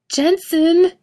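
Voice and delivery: high panicked shriek. A narrator's reading. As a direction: scream his name in panic. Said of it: "Misha shrieks".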